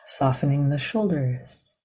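calm, measured meditation guidance with long pauses; guided meditation. Real, not acted. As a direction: guide them gently into the body, leaving silence between phrases.

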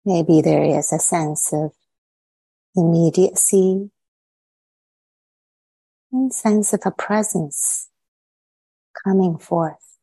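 unhurried meditation guidance with long pauses between phrases; measured phrases set apart by spacious silences; guided meditation. Real, not acted. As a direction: lead them inward, leaving silence after each short phrase.

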